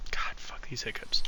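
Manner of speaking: annoyed whisper under breath. Real, not acted. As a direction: hiss it quietly to yourself. Annoyed muttering about hiccups.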